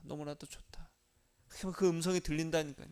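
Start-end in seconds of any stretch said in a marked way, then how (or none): 0.0-0.9 tender whisper. Pretend. Jesus whispering to the believer, tender whisper. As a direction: breathe it as a still small voice. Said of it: "세밀하게 탁 들리는 그 음성이".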